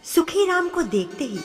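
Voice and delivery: ordinary delivery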